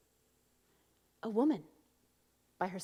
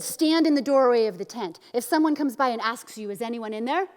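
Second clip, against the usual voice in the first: loud, forceful order; raised voice, imitating a man in command; manly commanding; 4 semitones higher, 10 dB louder than usual. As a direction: bark orders like a commanding warrior. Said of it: "he commands her in his manly voice".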